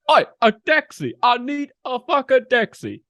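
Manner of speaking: high, loud hammed-up Boston taxi call; mock Boston accent. A host showing the only Boston lines he knows. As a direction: yell for a cab like a cartoon Bostonian. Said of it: "that's all I know".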